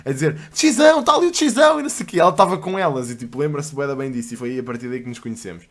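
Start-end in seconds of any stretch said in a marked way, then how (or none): 0.5-1.8 high squealing fangirl shout; screaming fans. Retold or imitated. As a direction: squeal it like excited fans spotting him. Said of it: "começarem aos gritos". (gritos histéricos de fãs)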